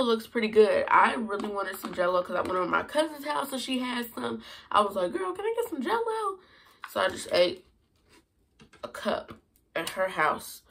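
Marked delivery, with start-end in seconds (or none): 5.1-6.4 retold request in a much higher put-on voice; high-pitched imitated plea. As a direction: ask like begging a cousin, voice pitched way up. Retells asking cousin for Jell-O.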